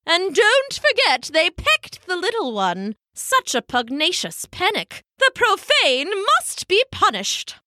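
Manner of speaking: high, indignant elder penguin; fussy high-pitched outrage; Pippo. A narrator's reading. Pippo indignant about the pecking.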